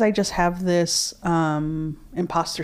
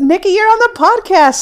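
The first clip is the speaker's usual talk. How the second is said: loud, high, thrilled announcement; excited; 10 dB louder than usual. Real, not acted. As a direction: tell a friend the news with delight. Excited phone greeting.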